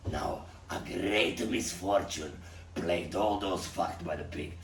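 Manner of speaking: usual speaking voice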